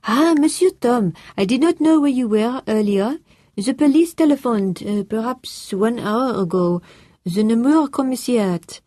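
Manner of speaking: put-on high, French-accented, polite and a little flustered; French-accented housekeeper. A narrator's reading. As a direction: play the French housekeeper, eager to report.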